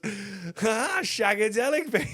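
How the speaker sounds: high-pitched mock groovy catchphrase; hammed-up swinger impersonation, pitched right up; Austin Powers. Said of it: "he's dressed as fucking Austin Powers".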